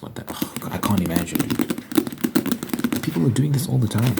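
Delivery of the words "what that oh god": whispering. Real, not acted. Aside after imagining sex.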